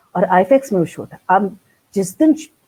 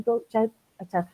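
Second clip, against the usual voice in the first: ordinary delivery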